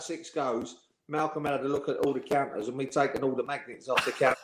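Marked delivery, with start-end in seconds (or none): none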